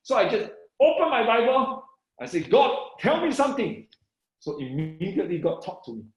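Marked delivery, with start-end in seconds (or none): 2.5-3.9 high, urgent plea to God; retold eager prayer; excited prayer. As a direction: beg for a word, pitch jumping up. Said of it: "It's just something that so exciting".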